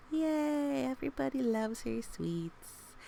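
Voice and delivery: high happy yay; cheer. Real, not acted. Reader cheering Kawamba's sweets, excited. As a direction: cheer for her success.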